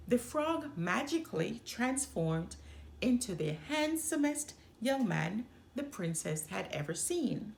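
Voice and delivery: ordinary delivery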